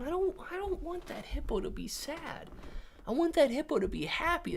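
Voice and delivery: high, soft pleading for a cartoon hippo; mock pity. Streamer feeling sorry for a sad little hippo, mock pity.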